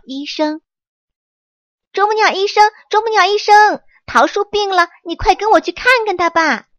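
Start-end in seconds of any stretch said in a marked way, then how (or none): 1.9-6.7 high, loud, breathless calling out; urgent calling. Acted. Monkey urgently calling the woodpecker doctor to help the tree. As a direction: call the doctor in a hurry, fast at the end.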